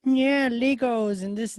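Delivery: put-on a touch of a little kid babbling about Legos; childish kid talk. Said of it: "getting out of kindergarten sports".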